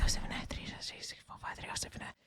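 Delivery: rapid rehearsal whispered under the breath; repeating to oneself. Shown as a demonstration. Teacher shows students how one memorises a phone number, whispering to oneself. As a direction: whisper the digits privately, like silent memorising. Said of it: "You're repeating it to yourself".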